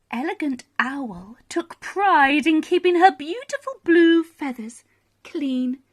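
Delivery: plain reading voice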